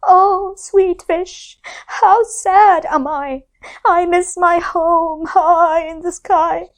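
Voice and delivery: high, slow sorrowful moon; sad voice. Acted. The moon sighing to the fish about home, sad. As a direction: sigh out the longing. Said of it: "In a sad voice".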